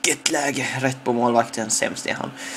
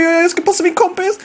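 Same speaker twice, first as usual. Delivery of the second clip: excited call. Real, not acted. Excited commentary building.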